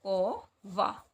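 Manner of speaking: कौवा stretched slowly; dictating कौवा slowly; slow dictation. Shown as a demonstration. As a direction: draw out कौवा for the class. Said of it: "क्या बन गया था आपका?".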